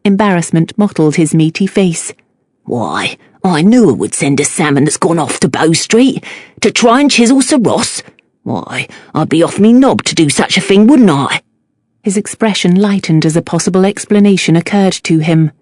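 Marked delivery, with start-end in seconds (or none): 2.7-11.4 lowered Cockney fishmonger voice; defensive Cockney shopkeeper; Cockney accent. A narrator's reading. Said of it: "thick Cockney accent".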